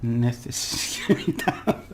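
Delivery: very slow, drawn-out Necessidad; slow careful try. Shown as a demonstration. Teacher untwisting a tricky word for learners. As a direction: ease through every syllable. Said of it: "that was done twisted".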